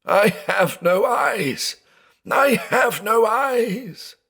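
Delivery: high, slow, anguished wail; sobbing cry. A narrator's reading. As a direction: mourn his blindness in despair.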